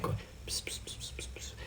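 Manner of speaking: voiceless, very soft psst sounds; tiny mocking mic whispers; mock ASMR whispering. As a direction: imitate someone whispering nonsense into a mic. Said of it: "I see people whispering into their mic".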